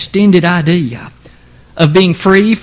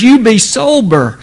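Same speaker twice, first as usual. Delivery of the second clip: raised, emphatic command on SOBER; urgent, earnest; 5 semitones higher, 4 dB louder than usual. Acted. Preacher voices Peter admonishing his listeners, urgent and earnest. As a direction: urge "You be sober" as an earnest warning. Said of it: "With great urgency and earnestness".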